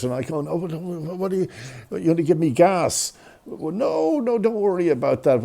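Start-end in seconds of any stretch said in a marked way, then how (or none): 0.4-3.1 high, acted panicky questioning; nervous stammer. Nervous patient questioning anaesthetist. 3.5-5.4 high-pitched, imitated anaesthetist; breezy reassurance. Anaesthetist brushing off patient's worry.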